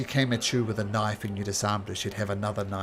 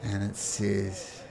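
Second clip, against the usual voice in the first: normal talking voice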